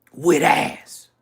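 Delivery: punched out loud for effect; loud emphasis. Real, not acted. To viewers about Atlanta women, loud emphatic. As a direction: hit "With ass" hard and loud. Stressing the point twice, loud then whispered.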